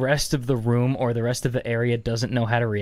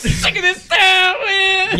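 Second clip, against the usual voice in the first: high, loud, slow, half crying; mock furious wail; 5 dB louder, much slower than usual. Friend imitating the viral guy's outburst, loud high slow wail. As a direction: howl it like a man at the end of his rope.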